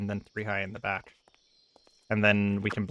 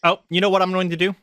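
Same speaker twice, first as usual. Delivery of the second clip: excited; 8 semitones higher, 8 dB louder than usual. Real, not acted. Sudden building idea.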